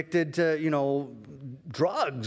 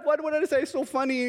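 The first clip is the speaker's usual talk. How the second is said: puzzled; 12 semitones higher, 3 dB louder than usual. Retold or imitated. Pastor puzzled by God.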